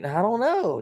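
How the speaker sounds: high, squeaky play-dumb voice; feigned innocence. Retold or imitated. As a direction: pretend you have no idea. Dodging the question.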